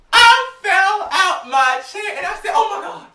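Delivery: high, loud, slow laughing squeal; laughing hysterically. Retold or imitated. Fan retelling tumbling off his chair, high laughing shriek. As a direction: laugh it out while falling over.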